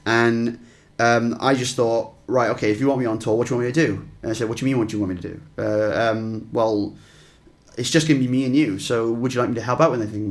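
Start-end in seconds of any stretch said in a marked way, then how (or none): none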